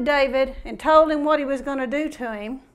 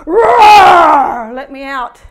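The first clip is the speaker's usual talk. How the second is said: huge shouted roar, then let me out; hammed-up monster roar demanding release; 15 dB louder, much slower than usual. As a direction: bellow like the Hulk breaking free. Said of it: "He's going".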